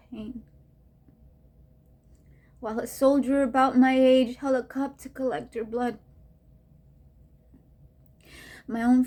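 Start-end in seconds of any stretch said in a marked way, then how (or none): none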